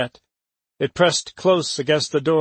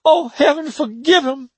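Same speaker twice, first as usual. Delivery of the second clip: put-on much higher, louder old servant's wail; horrified plea; 12 semitones higher, 4 dB louder than usual. A narrator's reading. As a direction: wail it to heaven. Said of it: "Aunt Maggie cried".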